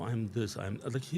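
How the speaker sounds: usual speaking voice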